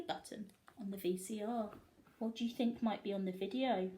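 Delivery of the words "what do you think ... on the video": plain reading voice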